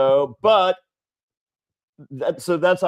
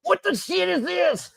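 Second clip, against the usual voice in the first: much higher mock old-lady voice; angry grandma. Imitating the angry grandma at the Halloween store. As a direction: squawk it like a cranky grandma. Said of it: "she's like".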